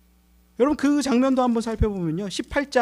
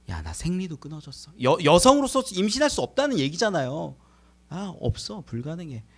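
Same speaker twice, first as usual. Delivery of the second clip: Sarah's inner voice; 4 semitones lower, 5 dB quieter than usual. Acted. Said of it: "사라 속으로 웃었대요".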